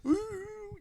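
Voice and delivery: very high squealed ooh; high giddy ooh; playful ooh. Retold or imitated. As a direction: squeal it like a kid. Playful ooh while walking.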